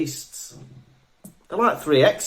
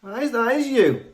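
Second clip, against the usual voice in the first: high, drawn-out teasing caricature; mimicking the co-host; 8 semitones higher, 5 dB louder, slower than usual. Co-host teasing that the impression is accurate, high drawn-out mimicry. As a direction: copy the friend's exaggerated impression, pitched up. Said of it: "that's pretty good".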